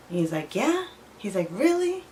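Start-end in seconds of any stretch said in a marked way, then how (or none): 0.6-0.9 high, eager rising question; mock-eager squeak of surprise; happy and excited. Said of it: "he was all happy and excited". 1.5-2.1 high, eager rising question; mock-eager squeak of surprise; happy and excited. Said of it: "he was all happy and excited".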